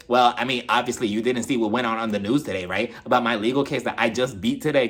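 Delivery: imitated low, even voice; Erica impression. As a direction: drop your pitch to play her. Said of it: "Erica goes on to say".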